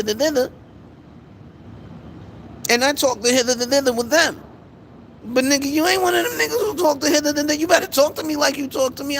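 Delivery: God calling out a friend for faking it, high and shouting; scolding God. Retold or imitated. God telling a praying homie to talk normal, very high shouting scold. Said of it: "God like".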